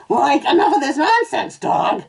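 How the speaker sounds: high, loud cartoon slacker voice; Shaggy. A narrator's reading. Cartoon owner scolds his dog, high and loud. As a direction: demand it in a high-pitched cartoon stoner voice. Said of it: "supposed to be Shaggy".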